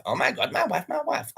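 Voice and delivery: ordinary talk, nothing marked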